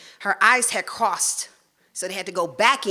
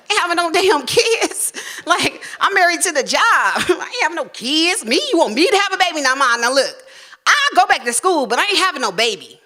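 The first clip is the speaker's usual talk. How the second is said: indignant protest; 9 semitones higher, 5 dB louder than usual. Retold or imitated. Arguing with mother about babies.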